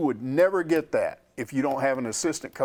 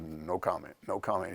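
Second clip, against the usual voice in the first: lower, softer, flat-out refusal; curt no comment; 7 semitones lower, 8 dB quieter than usual. Retold or imitated. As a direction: player walking away refusing reporters, curt and soft. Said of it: "and he said".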